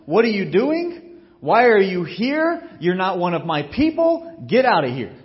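loud, high, harsh scolding of a stranger; rude, ruthless Boaz. Pretend. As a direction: chase her off the field, hammed-up rude landowner. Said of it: "He could have said".

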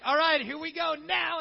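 excited call from the helm. Retold or imitated. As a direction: shout to dad on the catamaran. Calling to dad on the boat.

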